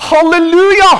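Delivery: high, loud jubilant shout; ringing praise cry; full-voice hallelujah. Shown as a demonstration. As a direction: shout hallelujah as heaven's crowd would.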